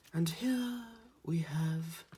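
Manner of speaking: slow, high, hushed nature-documentary narrator; drawn-out wildlife presenter voice, playful; David Attenborough impression. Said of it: "the one there he goes".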